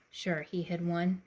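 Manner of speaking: ordinary delivery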